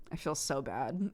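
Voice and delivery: low, soft guilty confession; imitating own guilty fretting; retold remorse. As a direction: drop to a small, rueful voice. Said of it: "I was sitting over there like".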